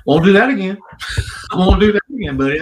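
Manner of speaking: loud, high, eager to make amends; loud promise. Retold or imitated. Scolded, promising never to repeat.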